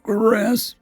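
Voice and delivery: coughed out. Acted. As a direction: cough the name weakly. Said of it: "I coughed".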